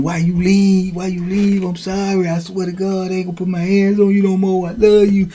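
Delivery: high, soft imitated begging; pleading. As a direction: plead like you're losing the love of your life. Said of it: "he really pleading like".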